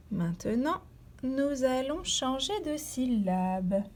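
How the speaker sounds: ordinary talk, nothing marked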